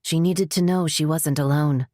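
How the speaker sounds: ordinary delivery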